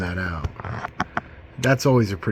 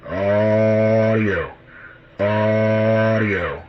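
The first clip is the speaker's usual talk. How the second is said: drawn-out mic test call; 5 dB louder, much slower than usual. Real, not acted. Radio test count into mic.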